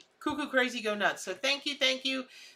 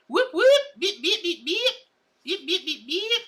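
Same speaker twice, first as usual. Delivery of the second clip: beeping noises; 9 semitones higher, 5 dB louder than usual. Acted.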